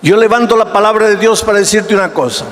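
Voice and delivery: usual speaking voice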